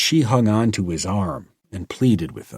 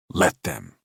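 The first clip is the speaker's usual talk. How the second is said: ordinary delivery, nothing marked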